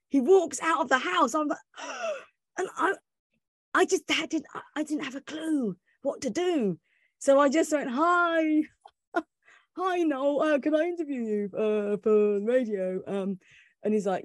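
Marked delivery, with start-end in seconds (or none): 0.1-6.8 high, breathless panic at meeting a star; gasping like a starstruck fan. Retold or imitated. Retelling bumping into [name], breathless excitement. 7.9-13.0 high, timid stammer; shy fan asking a big favor; nervous fan. Retold or imitated. Nervously asking [name] for a radio interview, timid.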